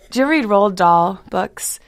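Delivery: ordinary talk, nothing marked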